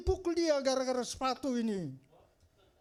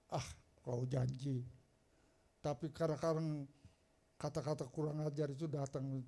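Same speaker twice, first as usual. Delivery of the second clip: quiet doubting; 6 semitones lower, 6 dB quieter than usual. Retold or imitated. Pastor doubting the promise.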